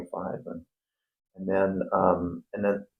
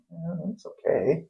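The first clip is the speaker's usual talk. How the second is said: high, slow, shrugging yeah it's okay; mock blasé, drawn out and high; unimpressed; 10 semitones higher, 5 dB quieter, slower than usual. Maserati driver shrugging it off, slow, high and unimpressed. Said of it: "you're just going".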